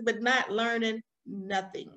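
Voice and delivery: ordinary talk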